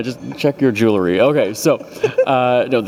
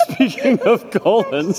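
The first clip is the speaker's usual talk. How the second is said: laughing; 8 semitones higher, much slower than usual. Real, not acted. Host laughing at guest's segue.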